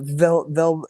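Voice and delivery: usual speaking voice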